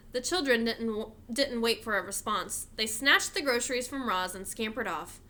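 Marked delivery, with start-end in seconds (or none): none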